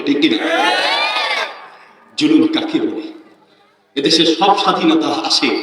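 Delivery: loud impassioned sermon voice; preaching at full force. Acted. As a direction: preach it hard to the crowd. Fiery sermon opening, full force.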